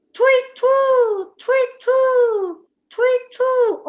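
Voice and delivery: high, loud tweet tweet hoot; playful owl imitation; owl hooting call. Shown as a demonstration. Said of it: "ma in inglese è".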